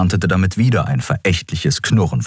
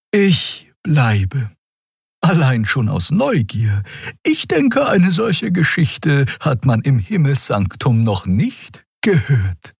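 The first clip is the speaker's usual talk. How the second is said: a higher character voice, composed; curious calm answer; 10 semitones higher than usual. Acted. Xia tells the captain she stays out of curiosity, composed. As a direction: stay because you're curious.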